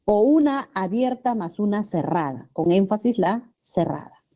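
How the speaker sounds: usual speaking voice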